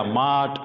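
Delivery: मार्ट said as a long maa with no r; non-rhotic मार्ट with a long father-like a; silent r, long a. Shown as a demonstration. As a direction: stretch the a in मार्ट and drop the r. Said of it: "फादर वाला आ हो गया".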